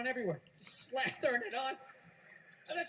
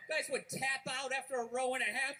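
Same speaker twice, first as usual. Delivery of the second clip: ordinary delivery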